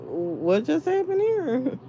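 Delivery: pretend confusion. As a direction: drag it out stunned. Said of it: "just looking like".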